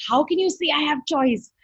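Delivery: indignant yelling. Retold or imitated. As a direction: protest indignantly, re-enacting a yell. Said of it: "I yelled at her".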